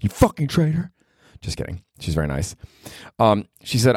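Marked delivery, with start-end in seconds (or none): 0.0-0.9 mock outrage. Mock insult to friend. 1.4-2.6 soft retraction. Real, not acted. Backing off joke.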